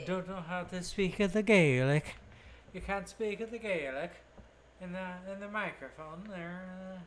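lightly put-on Irish accent; a hint of mock Irish accent. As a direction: tease in a bad Irish lilt. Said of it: "Is that how they talk?".

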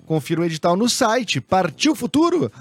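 upbeat radio ad read. Acted. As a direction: read it like a sponsor spot. Reads sponsored ad.

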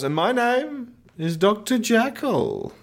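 mock Jekyll. Mocking Russell Crowe revealing his name, slow pompous high. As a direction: announce it grandly and slowly. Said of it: "Russell Crowe goes".

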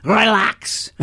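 doctor's stern order. Retold or imitated. Gynecologist ordering her patient to calm down, stern. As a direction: bark it like a strict doctor. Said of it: "she'd say it in an even more fierce voice".

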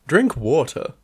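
ordinary talk, nothing marked

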